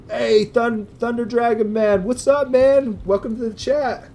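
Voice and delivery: high, loud, eager shout-out to a viewer; excited greeting. Real, not acted. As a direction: greet him like a long-lost buddy.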